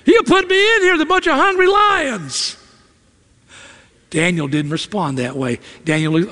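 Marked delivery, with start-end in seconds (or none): 0.0-2.6 high, mocking sarcastic jab; much higher sarcastic voice throwing blame; resentful retort. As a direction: snap back bitter at the king. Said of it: "You'd probably say something like".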